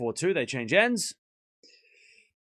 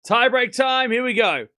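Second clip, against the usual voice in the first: much higher, loud hype call; excited shout; 10 semitones higher, 9 dB louder than usual. Real, not acted. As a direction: pump the chat up for the tie break. Tie break starting, hyping chat.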